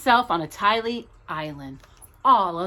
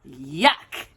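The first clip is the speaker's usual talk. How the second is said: low, drawn-out yuck; disgusted yuck; 7 semitones lower than usual. A narrator's reading. Reading to a child, a low playful yuck of disgust. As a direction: make a face with the word. Disgust at aliens holding hands.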